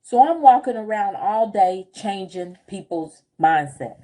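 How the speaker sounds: ordinary talk, nothing marked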